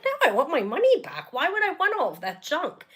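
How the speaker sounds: imagined sharp comeback, said a bit tensely; indignant inner retort. Retold or imitated. To customer support, imagined indignant refusal. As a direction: snap back as you wished you had. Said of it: "I didn't say it like that. That's in my head".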